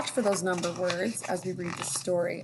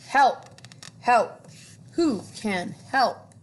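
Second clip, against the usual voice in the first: duck crying for help; 4 semitones higher, 6 dB louder, much slower than usual. Acted. Stuck duck calls for help.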